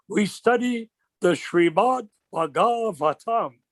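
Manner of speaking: slow, weighty imitation of the senior godbrother; slow solemn quote. Senior godbrother lecturing a new devotee, slow and solemn. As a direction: draw out each word like a wise elder. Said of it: "That's the way he said it".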